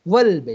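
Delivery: والبیت said as one joined word, hamza dropped; model pronunciation. Shown as a demonstration. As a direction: give والبیت firmly as the model answer. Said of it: "بلکہ کیا پڑھیں گے". (والبیت صاف ملا کر ادا کرنا)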